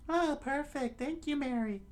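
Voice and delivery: very high, put-on girlish voice; squeaky falsetto thanks; falsetto lady. Kaya thanks her butler for the order, falsetto lady voice.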